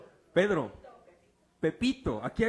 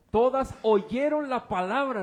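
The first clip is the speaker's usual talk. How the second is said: louder, slow, oyeron punched; emphatic preaching; 3 semitones higher, 6 dB louder, slower than usual. Acted. Preacher insists to congregation, emphatic. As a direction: lean hard on oyeron. Preacher drives home point.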